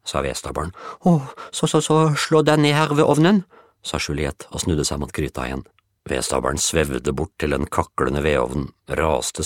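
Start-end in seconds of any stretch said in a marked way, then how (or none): 1.1-3.4 high, loud stammering welcome; nervous stammer. Acted. Juliette nervously inviting the woodpile to sit by the stove.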